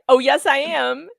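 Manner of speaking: slow, sing-song defiance; mock defiant. Mocking the lawyer defying the judge, slow and defiant. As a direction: dig in like a stubborn child.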